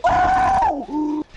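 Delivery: sudden high shocked yelp; startled yelp. Real, not acted. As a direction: yelp in fright. Caught by the killer.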